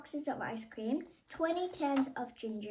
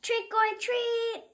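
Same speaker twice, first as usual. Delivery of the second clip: higher, louder, slow call; louder slow trick or treat; a little louder; 10 semitones higher, 7 dB louder, slower than usual. Acted. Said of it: "He said it a little louder".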